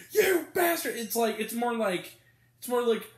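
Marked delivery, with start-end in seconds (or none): 0.1-0.9 high hammed-up shout of "you bastard"; mock angry yell. A mean dad yelling at his kids, hammed-up. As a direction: fake a furious outburst. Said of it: "he's not like".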